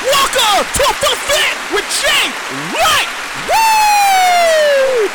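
high, loud showman welcome; excited welcome. Real, not acted. Host greeting listeners, high excited announcer call. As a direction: belt the show's name with a whoop.

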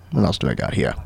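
low, soft aside under the breath; muttered to self. Real, not acted. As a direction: murmur it while checking notes. Muttering to himself, looking through notes.

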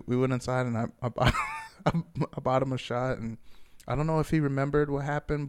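ordinary delivery

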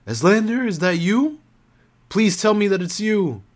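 high, loud pleading cry; frightened woman calling out to Eslander, pleading; crying out in fear. A narrator's reading. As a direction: beg him to be there. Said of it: "she cried out".

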